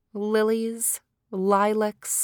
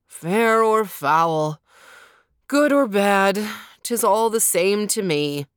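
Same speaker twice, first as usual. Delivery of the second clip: put-on gloomy young lord; 3 dB louder than usual. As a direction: grumble it out, weighed down.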